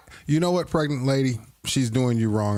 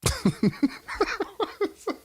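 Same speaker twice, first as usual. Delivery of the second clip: high breathy giggle. Real, not acted. Laughing at his own joke.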